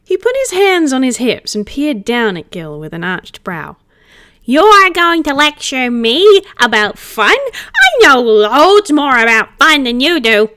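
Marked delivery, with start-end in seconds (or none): 4.4-10.5 loud, slow affronted retort; indignant retort. Acted. Spirit looming over Gil, boasting about fun, affronted. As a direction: rise up offended and boast.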